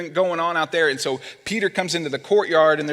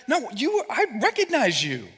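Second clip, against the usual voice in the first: high-pitched voice of someone pointing a finger; acted-out recognition, pitched up; insistent accuser; 8 semitones higher than usual. Bystanders contradicting Peter's denial, accusing.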